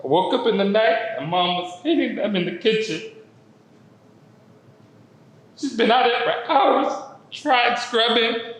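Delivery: tearful, high-pitched telling through a tight throat; choked up. Real, not acted. Telling of mom scrubbing the shoes at night, choked up.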